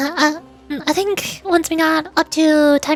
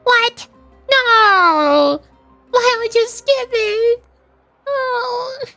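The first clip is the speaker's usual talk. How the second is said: high-pitched, drawn-out protest; mock dismay; 9 semitones higher, much slower than usual. Streamer learns viewer skipped the story, mock dismay.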